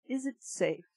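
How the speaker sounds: usual speaking voice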